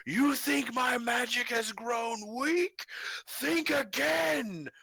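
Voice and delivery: slow, high, booming villain roar; booming shout. Acted. Robed sorcerer challenging the party as battle starts, booming shout. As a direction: bellow it like a returning dark sorcerer. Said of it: "shout in this booming voice".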